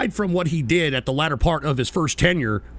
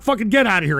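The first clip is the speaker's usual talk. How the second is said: ordinary talk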